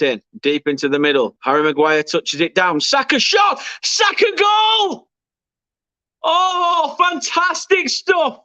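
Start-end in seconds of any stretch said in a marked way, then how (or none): 2.8-3.6 excited. Real, not acted. Goal build-up in live commentary. 3.8-5.1 stretched, high screaming "goal"; long goal yell. Real, not acted. Goal celebration in live commentary. 6.2-8.4 high, slow, drawn-out celebration; ecstatic yelling. Real, not acted. Goal celebration in live commentary.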